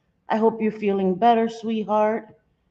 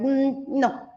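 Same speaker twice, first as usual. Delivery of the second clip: mock refusal; 7 semitones higher than usual. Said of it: "I was like".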